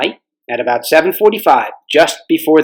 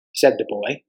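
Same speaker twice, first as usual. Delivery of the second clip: ordinary delivery, nothing marked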